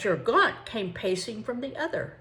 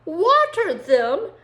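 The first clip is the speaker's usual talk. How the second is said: slow, much higher and louder outraged cry; incredulous exclaim; 11 semitones higher, 11 dB louder, slower than usual. Acted. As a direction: throw the words back in disbelief. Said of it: "exclaimed the Frenchman".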